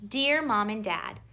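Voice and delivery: ordinary delivery, nothing marked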